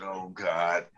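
low, soft mock dread; dismayed aside. Asked to examine her arm, dismayed aside. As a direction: say it low to yourself, sinking.

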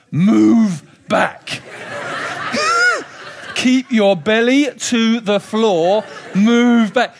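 loud, slow, commanding dad voice; sudden alarmed command; stern shout. Retold or imitated. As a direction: bark the order to get back from the edge. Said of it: "my whole tone changed".

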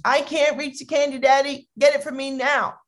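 put-on high-pitched whiny child; acted tantrum in a kid voice; demanding son. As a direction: imitate a bratty boy nagging daddy. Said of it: "Then the son looks up and he goes".

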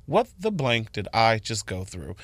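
quiet disbelief to yourself; stunned mutter. Retold or imitated. Alone in the car after the shift, slow soft disbelief. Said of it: "I was like".

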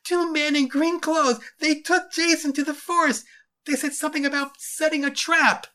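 put-on very high, wailing boy; distraught, high-pitched cry; loud lamenting. A narrator's reading. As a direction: wail the news out in panic. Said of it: "they heard Arthur lamenting".

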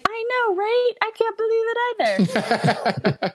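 mock agreeing voice. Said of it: "I was like".

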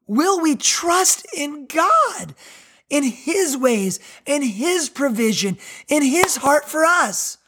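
slow pleading. Real, not acted. Pleading question to congregation.

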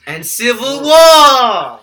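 dramatic announcement. Acted. Dramatic announcement of another war.

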